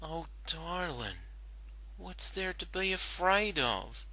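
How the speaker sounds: quietly. Acted. Applejack comforting crying Rainbow, quiet and gentle. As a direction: ask softly to soothe her. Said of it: "Applejack asked quietly".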